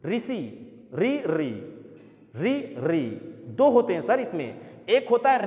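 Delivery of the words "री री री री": री with tongue vibrating, said slowly and clearly; ri demonstrated. Shown as a demonstration. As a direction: let the tongue tremble on री. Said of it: "बोल के देखो".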